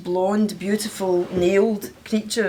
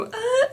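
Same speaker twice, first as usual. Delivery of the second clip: Glaswegian grunt. Retold or imitated. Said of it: "they just go".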